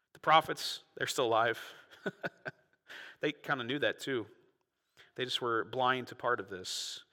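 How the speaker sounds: ordinary delivery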